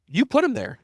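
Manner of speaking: high, loud mock reprimand; blunt self-scolding. Reality answering a self-pitying founder, blunt scolding. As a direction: act out a hard reality check.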